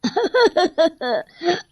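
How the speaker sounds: high gleeful witch cackle. Acted. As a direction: cackle like a witch clinging beneath. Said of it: "जोर जोर से हंसते हुए बोलती है".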